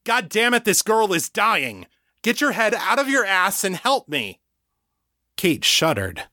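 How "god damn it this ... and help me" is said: high furious yell; angry yelling. Acted. John yelling at Kate to help dying girl, furious. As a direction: yell at her to snap out of it.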